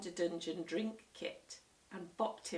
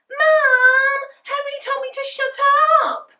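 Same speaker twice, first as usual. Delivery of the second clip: high, loud tattling wail; 19 dB louder than usual. A narrator's reading. Peter telling on Henry, loud high wail. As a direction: wail it to Mum like a crybaby. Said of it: "wailed Peter".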